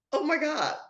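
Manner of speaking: high, sobbing cry of "Oh my God"; overwhelmed tearful outburst. Retold or imitated. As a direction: break down crying as you cry out. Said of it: "I just started crying".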